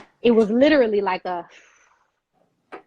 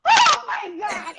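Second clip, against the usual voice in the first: high, drawn-out exclamation of shock; retold astonishment; 11 semitones higher, much slower than usual. Lady on the phone learning of approval, astonished. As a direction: act out a stunned shriek, stretched slow.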